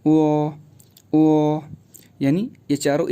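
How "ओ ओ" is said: ओ said as a rounded, held o; ओ, lips rounded; the shared o ending shown alone; pinyin o. Shown as a demonstration. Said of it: "एक ही sound आ रही है".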